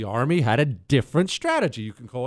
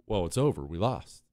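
defeated murmur; 8 semitones lower, 7 dB quieter than usual. Pretend. Imagined defeated inner thought.

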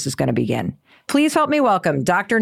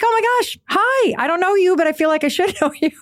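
much higher, gushing, laughing at the end; excited greeting; 12 semitones higher, faster than usual. Retold or imitated. Host greeting a striking stranger, much higher and laughing. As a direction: bubble over meeting a stranger. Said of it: "I'm like".

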